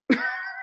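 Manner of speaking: short high burst of laughter; a quick bright laugh. Real, not acted. Teacher laughing at the idea of an agreement.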